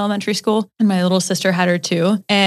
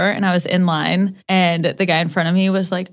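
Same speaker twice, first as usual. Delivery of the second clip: ordinary talk, nothing marked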